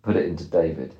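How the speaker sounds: low, toneless mimicry of a caller; mock a curt caller, pitched low; flat, unfriendly. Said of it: "You were quite flat".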